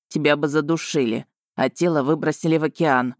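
lightly voiced male dragon, pitched a little down; stern dragon Vargan. Dragon tells Marina of her grim fate, stern.

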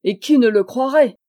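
bien plus fort, indigné. Acted. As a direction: lance la question, outré. Simon indigné. (Simon réplique, indigné et fort)

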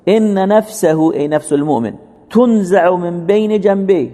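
high, measured sermon cadence with stretched Arabic phrases; impassioned preaching. Real, not acted. Preacher telling the congregation all is good for the believer, impassioned preaching. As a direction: proclaim the hadith slowly, pitched well up.

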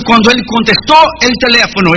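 usual speaking voice

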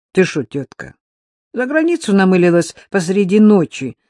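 jolted awake by astonishment; surprised. A narrator's reading. Brother learns she wants her passport at night, surprised. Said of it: "удивлённо спросил Сергей".

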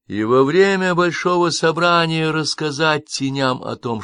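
ordinary delivery, nothing marked

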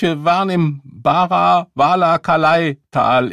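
high, loud, slowed-down rant; slow ranting veteran. Acted. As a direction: drag the memory out. Phrase again in rant.

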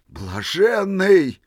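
high, very slow, drawn out in awe; admiring cry. A narrator's reading. Old man admiring Misha, crying out in rapture. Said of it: "восхищённо крикнул".

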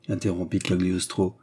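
usual speaking voice

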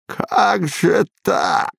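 slow, drawn-out hiss. A narrator's reading. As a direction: hiss it out in shock. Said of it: "прошипел он".

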